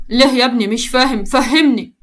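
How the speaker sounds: ordinary delivery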